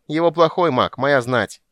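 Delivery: high, loud, self-assured squeal; high-voiced indignant boast; little creature boasting. A narrator's reading. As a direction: declare it as a cocky small creature. Bupu keeps boasting in creature voice.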